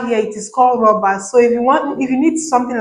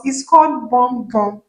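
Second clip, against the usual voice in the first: normal talking voice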